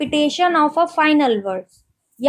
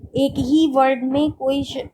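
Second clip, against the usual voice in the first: usual speaking voice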